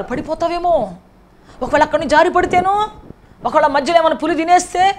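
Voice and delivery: mock-fearful naysayers' warnings; loud discouraging warnings. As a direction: scare him off the climb. People discouraging David.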